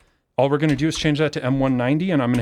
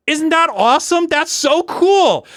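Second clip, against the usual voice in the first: thrilled; 11 semitones higher, 4 dB louder than usual. Real, not acted. Cheering his own open-source fix.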